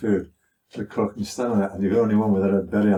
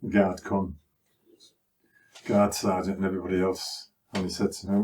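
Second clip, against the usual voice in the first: ordinary talk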